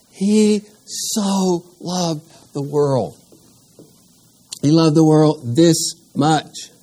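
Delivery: very slow, every word weighed; slow awed emphasis. Shown as a demonstration. Teacher marvelling at John three sixteen, slow and emphatic. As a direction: stretch out "He so loved the world" in wonder. Repeating the verse with amazement.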